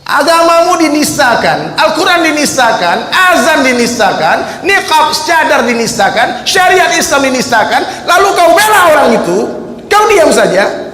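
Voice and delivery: high, loud and slow, each phrase hammered; fiery preaching. Acted. Preacher rebuking silent Muslims, booming and slow. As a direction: rouse the crowd with rising anger.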